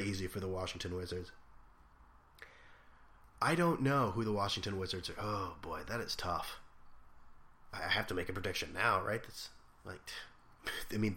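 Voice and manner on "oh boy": ordinary talk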